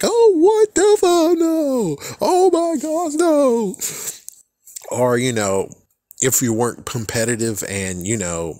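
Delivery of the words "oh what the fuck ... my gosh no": very high, slow, louder comic shriek of dread; mock panicked shriek. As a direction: shriek as the opponent plays Exodia. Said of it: "It's like".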